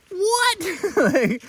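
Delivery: a high shocked yelp; shocked what. Retold or imitated. Hiker hearing the grouse is fresh, high shocked yelp. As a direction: jump way up in shock.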